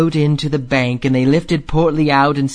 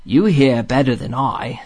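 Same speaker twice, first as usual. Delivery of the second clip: ordinary delivery, nothing marked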